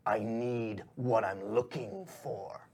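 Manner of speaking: whispery, dissatisfied villain; hushed menace, slowing right down on the second sentence; menacing shadowy boss. Acted. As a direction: whisper it slowly with cold displeasure. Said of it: "he throws it in his face and he goes".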